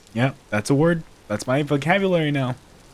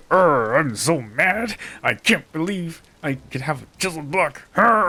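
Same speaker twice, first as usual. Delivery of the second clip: mock grumpy pirate; 4 semitones higher, slower than usual. Offering viewers something different, a hammed-up sulky pirate grumble. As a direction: play a sulky pirate, half-hearted. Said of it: "here's something different".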